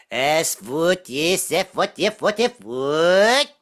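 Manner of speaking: backwards-talk fake Swedish with rising lilts; singsong nonsense Swedish, rising at the end; mock Swedish. Shown as a demonstration. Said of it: "anybody can talk Swedish".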